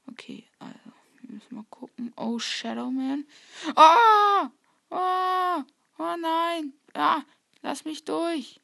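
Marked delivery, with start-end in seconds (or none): 0.1-2.1 whispering. Real, not acted. Sneaking in horror game. 3.8-8.6 high panicked screams; panicked screaming. Real, not acted. Attacked by Shadow Man.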